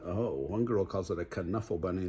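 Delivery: usual speaking voice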